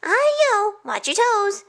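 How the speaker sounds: high, cheeky warning call; rice cake cry. A narrator's reading. Rice cake jumping past lion dancers, high teasing cry. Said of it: "It cried".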